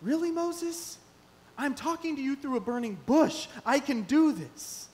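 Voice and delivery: high, teasing voice of God; incredulous God. Retold or imitated. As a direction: talk down to Moses in disbelief, slowing at the end. God answering Moses in sermon.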